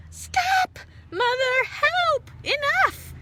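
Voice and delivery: very high, loud screaming cry; a tiny one drowning in water; shrill panicked cries. A narrator's reading. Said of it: "Eulalie screamed".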